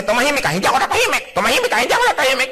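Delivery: high, fast bickering; quarrel. Acted. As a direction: rattle it off like a spat. Acted quarrel between spouses.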